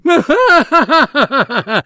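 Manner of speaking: high sneering guffaw; mocking elephant laugh. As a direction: laugh at how small they are. (risata beffarda dell'elefante)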